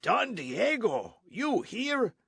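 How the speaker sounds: stunned soldier, voice jumping high; surprised gasp. A narrator's reading. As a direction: gasp out his name in disbelief. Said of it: "Gonzalez gasped".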